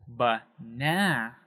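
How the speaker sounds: slow "banana" with the middle "na" drawn out; exaggerated stress on the middle of "banana"; stressed second syllable. Shown as a demonstration. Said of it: "stressed or emphasized more than the other".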